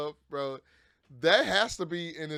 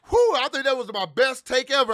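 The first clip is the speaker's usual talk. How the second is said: high, louder, pleased with the take; proud excited relief; 9 semitones higher, 9 dB louder than usual. Retold or imitated. Singer after a great take, proud and loud. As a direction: savor the moment. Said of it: "You're like".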